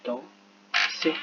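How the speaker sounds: danser slowly split into dan... ser; syllable by syllable. Shown as a demonstration. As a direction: sound out danser while writing it. Sounding out the word while writing.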